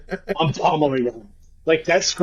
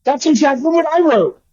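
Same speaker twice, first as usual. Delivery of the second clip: high, loud delighted exclaim; excited disbelief; 11 semitones higher, 3 dB louder than usual. Real, not acted. Co-host's prediction matches, high and excited. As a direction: burst out amazed.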